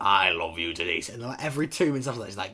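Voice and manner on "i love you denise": lightly voiced adoring older fan; a hint of a doting middle-aged fan; imitating a fan. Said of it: "That is genuinely her voice".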